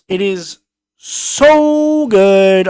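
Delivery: very slow, stretched so good; slow savoring. Real, not acted. Reviewer giving the A plus verdict, slow and savoring. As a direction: linger on every word of the praise.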